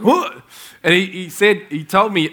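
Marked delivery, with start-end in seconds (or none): 0.0-0.4 high, sharp startled "What?". Retold or imitated. Friend woken by a late call, startled high yelp. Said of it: "Patrick's like".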